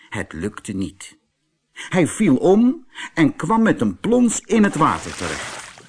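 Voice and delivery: plain reading voice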